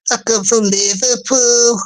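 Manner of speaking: a light put-on Liverpool accent, "Liverpool" stressed; a playful, lightly done Scouse voice; mock Scouse accent. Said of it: "These accents are grim".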